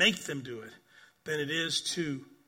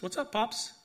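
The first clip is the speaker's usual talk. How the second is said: lightly voiced child, pitched higher and bright; a hint of a five-year-old greeting grandpa; little granddaughter; 7 semitones higher than usual. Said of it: "she says".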